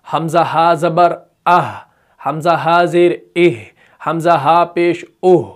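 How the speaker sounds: slow letter drill with throaty आह. Shown as a demonstration. Teacher drills हा with each vowel slowly. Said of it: "एक vibration पैदा हो रही है".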